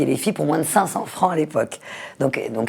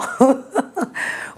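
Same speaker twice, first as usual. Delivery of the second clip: high little laugh; 11 semitones higher than usual. Real, not acted. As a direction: laugh lightly. Guest laughs at her own joke.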